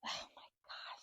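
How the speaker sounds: almost soundless horror; appalled whisper. Real, not acted. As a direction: breathe it out in a tiny, shocked whisper. Hears she nearly died.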